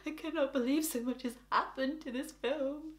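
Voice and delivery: very soft, small whimpering voice; mock-distraught murmur; imitated needy disbelief. Acting herself needy after the film.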